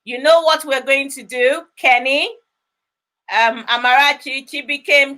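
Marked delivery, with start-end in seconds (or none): none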